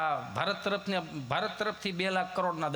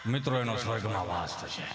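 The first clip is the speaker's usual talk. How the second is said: mock preacher; 9 semitones higher than usual. Mimicking preacher promising heaven.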